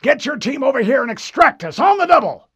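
high, loud, demanding officer voice; angry admiral. Acted. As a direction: bark like an impatient admiral. Admiral orders.

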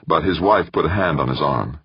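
ordinary delivery

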